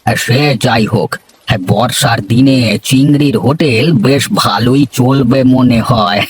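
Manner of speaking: ordinary delivery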